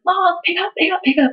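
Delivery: high-pitched retold plea; urgent pleading. Daughter phoning mom over and over, mock desperation.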